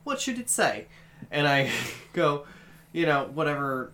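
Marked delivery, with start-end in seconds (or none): 0.0-0.9 high-pitched Australian voice assistant; mock Australian assistant voice; Australian accent. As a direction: imitate an Australian Siri, pitched way up. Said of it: "I have it set to the Australian accent".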